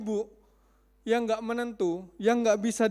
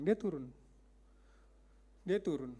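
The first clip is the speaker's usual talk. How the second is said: normal talking voice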